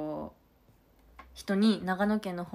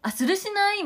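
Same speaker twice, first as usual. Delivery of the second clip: high, loud delighted surprise; suddenly recognizing a word; bright surprised exclamation; 12 semitones higher, 6 dB louder than usual. Real, not acted. Reacting to viewer's dialect comment.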